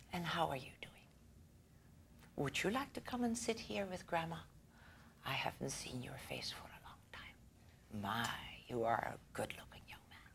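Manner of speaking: put-on soft, hushed, fond old grandma; whispered granny beckoning the boy; grandma's voice. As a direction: coax him over like a doting grandmother. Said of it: "grandma talked like this".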